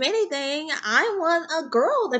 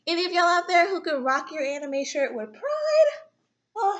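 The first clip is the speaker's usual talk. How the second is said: ordinary delivery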